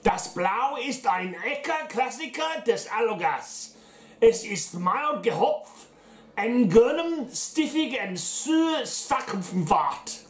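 put-on slow, high, hammy German accent. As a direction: read it like a proud Bavarian. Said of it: "Give me a chance to get my German accent out".

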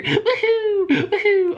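high joyful whoop; excited whoop. Real, not acted. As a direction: cheer like you just won. Celebrating escape.

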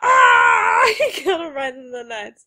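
loud, high laughing yell; laughing shout. Real, not acted. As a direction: howl it out while laughing. Shocked laughing at hit.

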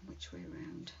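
a hushed question under the breath; whispered to self. Real, not acted. To herself while arranging the jug, whispering. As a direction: whisper it, just thinking aloud.

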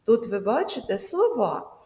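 normal talking voice

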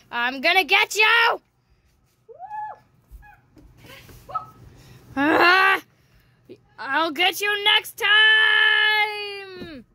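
high, loud villain threat; sneering panto villain. Acted. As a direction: taunt like a cartoon baddie. Villain chasing player in game.

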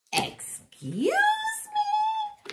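hushed start leaping to a squeak; affronted mouse. A narrator's reading. Mouse challenges the one taking credit, squeaky affront. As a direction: draw it out, shooting up high. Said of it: "Mouse said".

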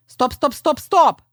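ringing rooster calling a halt; high, ringing command; ringing shout. A narrator's reading. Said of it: "дзвінкий голос пана Півня".

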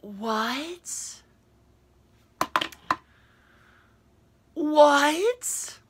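high, stunned disbelief; stunned what. Real, not acted. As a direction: squeak it out, floored. Repeated disbelief at news.

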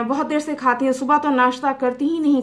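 ordinary talk

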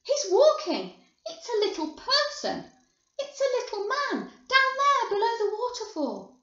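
put-on high, loud child's squeal; screams of excitement. A narrator's reading. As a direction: shriek each discovery as it comes. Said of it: "screams of excitement".

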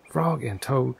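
usual speaking voice

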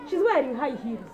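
ordinary talk

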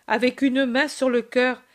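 usual speaking voice

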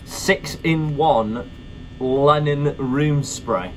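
slow reading. Acted. Reading product name slowly.

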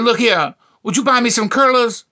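high, loud request; imitated demanding woman. Woman asks him to buy curlers, high loud imitation.

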